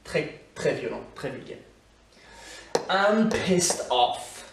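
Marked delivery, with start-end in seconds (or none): 2.9-4.5 very slow, high and louder, each word apart; slow teaching demo. Shown as a demonstration. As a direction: drill it out word by word for learners. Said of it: "demo of the expression". (très lent, articulé pour l'élève)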